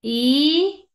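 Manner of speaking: held "I" as a rising eee; long E sound. Shown as a demonstration. Teacher drilling long against short, stretched "I". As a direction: stretch "I" as ee, lifting at the end. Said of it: "Sonido largo".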